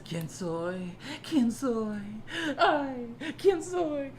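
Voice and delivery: sneering high imitation of a moping singer; mocking his song. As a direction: parody his angst, rising to a high laughing wail. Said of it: "where he says".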